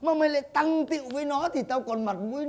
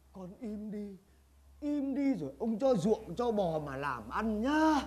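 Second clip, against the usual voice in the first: low, much softer conspiratorial voice; hushed coaxing; 4 semitones lower, 8 dB quieter than usual. Acted. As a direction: keep it quiet, persuade him softly. Master coaxes servant secretly.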